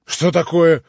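громко и высоко, в изумлении; переспроси, остолбенев; ошеломлённо. A narrator's reading. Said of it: "остолбенел Иван". (ошеломлённый громкий вопрос)

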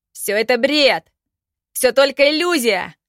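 desperate howl, loud and high. Acted. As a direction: howl it out in despair. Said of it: "взвыл Ардан".

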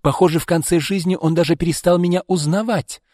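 high, loud and exasperated; irritated cry. A narrator's reading. Izolda complains to Arkady about their father, irritated. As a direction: complain loudly, with the pitch well up. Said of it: "раздражённо крикнула Изольда".

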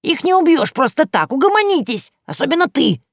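high, loud scolding crone voice; stern old Yaga. Acted. Yaga scolds the girls, high and loud as an old crone. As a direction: frown and order them to calm down. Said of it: "грозно хмуря брови".

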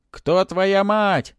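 loud, high, long-drawn cry; shouting spirits. A narrator's reading. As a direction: holler the question, stretching it out. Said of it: "закричали духи".

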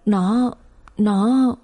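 mildly choked, drawn-out stammer; choked sobbing. A narrator's reading. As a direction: break off as if about to sob. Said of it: "Bà khóc nấc lên". (nghẹn ngào, ngập ngừng)

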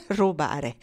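scornful sneer. Acted. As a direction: throw "Rubare" back with a sneer. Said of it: "con un ghigno sprezzante". ("Rubare" ripetuto con ghigno sprezzante, più basso)

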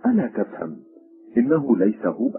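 ordinary delivery

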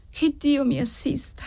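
high, soft, frightened plea; fearful prayer. A narrator's reading. Daughter dreading the spirit, praying in fear. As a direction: breathe it out small and scared. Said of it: "in preda a un tremore invincibile".

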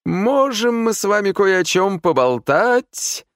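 high, slow wheedling question; voice of a cream-fed cat. A narrator's reading. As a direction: purr a favour out of them. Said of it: "голосом кота, который от души поел сметаны". (вкрадчиво, как сытый кот)

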